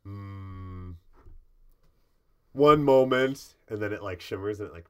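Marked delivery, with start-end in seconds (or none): 2.5-3.5 loud, slow and stately; drawn out, loud and grand; stately door. Acted. Gnome door stalling the salesman, loud and slow.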